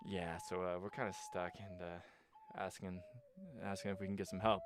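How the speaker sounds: soft, low, sheepish plea for help; sheepish ask. Retold or imitated. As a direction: mumble it like you're embarrassed to ask. Friends sheepishly asking for help.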